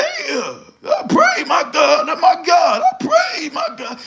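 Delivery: high, hammed-up shouting imitation of her; mock shouted praise. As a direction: yell it like she's testifying in church. Mocking her shouted praise rant.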